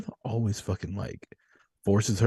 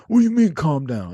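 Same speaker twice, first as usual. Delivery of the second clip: mock angry comeback, louder and higher; imitating an angry retort; 7 semitones higher, 7 dB louder than usual. Retelling the drunk guy snapping back at the streamer, mock angry. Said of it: "And he goes".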